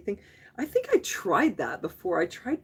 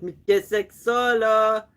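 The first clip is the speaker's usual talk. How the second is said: mock passerby; 3 semitones higher, 7 dB louder, slower than usual. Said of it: "They're gonna be like".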